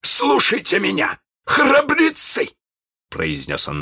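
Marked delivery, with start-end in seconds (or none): 0.0-2.5 high, commanding pirate captain; resolute. Acted. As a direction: call the captives to attention, stretching the last word. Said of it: "наконец, решительно".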